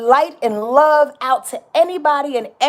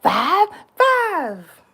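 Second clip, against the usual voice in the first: lightly voiced movie lady, high and pleading; a hint of the dreaming woman betting her number; 8 semitones higher than usual. Quoting the lady betting on her dream number, lightly voiced. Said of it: "Remember when the lady said".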